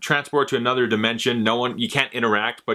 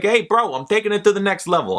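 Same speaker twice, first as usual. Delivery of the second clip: mock little brother brag; 7 semitones higher than usual. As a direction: show off to your big brother. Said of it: "he's like".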